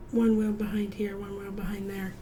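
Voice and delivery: whispered under breath; soft unvoiced aside. Real, not acted. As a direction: whisper it to yourself while drawing. Whispered aside while drawing.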